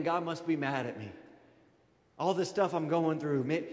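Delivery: normal talking voice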